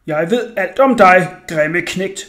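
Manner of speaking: slow, loud mock-man growl. A narrator's reading. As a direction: growl it slowly at the cornered boy. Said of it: "brummede jeg".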